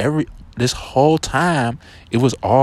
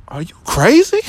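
higher and louder, outraged question; stunned disbelief; 9 semitones higher, 5 dB louder than usual. Retold or imitated. Answering the girl's excuse, higher and stunned. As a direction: blurt it out in shock. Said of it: "I'm like".

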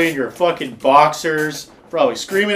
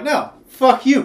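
mock angry comeback; 8 semitones higher than usual. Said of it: "they're like".

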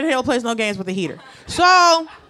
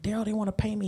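softer hushed plea, almost breathless; hushed whisper; 4 semitones lower, 10 dB quieter, faster than usual. Retold or imitated. Asking the boss for her pay, a hushed polite whisper. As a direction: keep it very soft and low-key, politely asking. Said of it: "I love the whisper that you've adopted".

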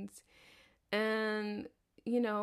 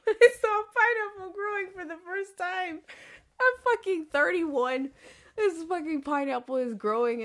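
higher and louder, bursting with delight; thrilled, much higher and louder; excited; 7 semitones higher, 6 dB louder than usual. Real, not acted. Gushing about seeing a pineapple.

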